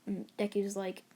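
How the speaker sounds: ordinary delivery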